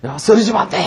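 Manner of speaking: high-pitched mock panic; urgent calling. Child urging fainted friends on as they retreat, urgent cry. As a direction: cry out to a falling comrade. Acted children urging each other while fleeing.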